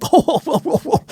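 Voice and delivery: very high squeaky repeated oh; Shannon Sharpe impression. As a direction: falsetto string of oh-oh-oh. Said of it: "Shannon Sharpe was like".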